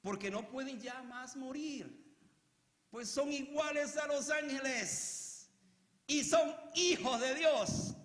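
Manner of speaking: slow, high, drawn-out proclamation; scripture reading. Real, not acted. Preacher reading verse thirty-six to the church, slow proclamation. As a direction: read the verse out solemnly, building in force.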